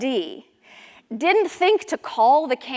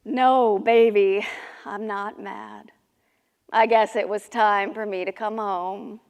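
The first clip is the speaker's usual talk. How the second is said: low, soft fatherly tenderness; gentle reassurance; 7 semitones lower, 6 dB quieter, slower than usual. Acted. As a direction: reassure your child quietly. Father gently reassures his child.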